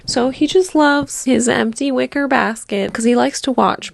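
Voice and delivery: ordinary delivery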